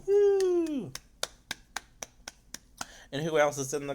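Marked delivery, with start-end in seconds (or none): 0.1-0.9 high, loud sustained "woo"; celebratory whoop. Real, not acted. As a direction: cheer with a long piercing whoop. Cheers the character's arrival.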